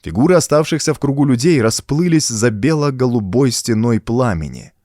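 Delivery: plain reading voice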